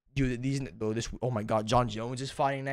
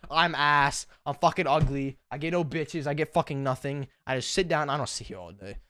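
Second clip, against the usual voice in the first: ordinary delivery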